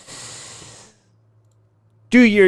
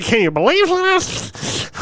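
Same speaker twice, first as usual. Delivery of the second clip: higher, slow mock valley girl; Valley girl mimic; 10 semitones higher, slower than usual. Streamer parodying the co-host, slow mock Valley girl. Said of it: "He talks like a fucking Valley girl".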